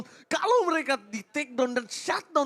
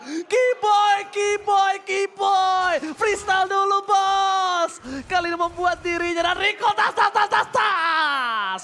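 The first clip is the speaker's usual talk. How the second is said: higher, loud repeated hype chant; excited shout; 8 semitones higher, 4 dB louder, much slower than usual. Real, not acted. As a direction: chant the name, building. Caster chants player name, hype.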